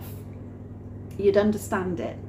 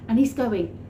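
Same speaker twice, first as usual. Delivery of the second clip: usual speaking voice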